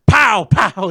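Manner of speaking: loud mock gunshots with a laugh; gunshot noises. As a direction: shout pow like a gun going off. Gunshots imitated while retelling.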